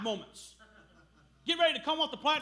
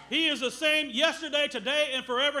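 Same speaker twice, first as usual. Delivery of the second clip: shouted, rising preacher's proclamation; preaching at full force; 4 dB louder than usual. Acted. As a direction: call it out to the whole church. Preacher proclaims God's constancy.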